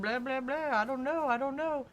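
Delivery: high, dismissive whiny shrug; mock clueless staff. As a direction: brush off the customer. Mocking dealership staff.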